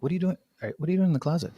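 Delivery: high, puzzled what are you doing; baffled friend calling out; incredulous question. Retold or imitated. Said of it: "I'm like".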